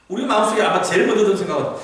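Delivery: ordinary delivery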